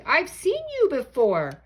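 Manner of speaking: high, loud surprised cry; startled gasp. Acted. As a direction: catch him by surprise. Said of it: "gasped Maisie".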